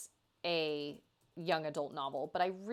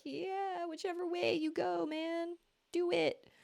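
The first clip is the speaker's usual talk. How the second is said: carefree shrug; 11 semitones higher, slower than usual. Retold or imitated. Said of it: "I'm just kinda like".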